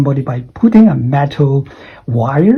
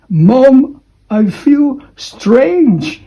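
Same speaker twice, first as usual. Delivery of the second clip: acted woozy kid; 9 semitones higher, much slower than usual. Light-headed calling to mom.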